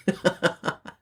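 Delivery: short run of chuckles; laugh. Real, not acted. Host struggling with the name, amused chuckling. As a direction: chuckle at your own stumble.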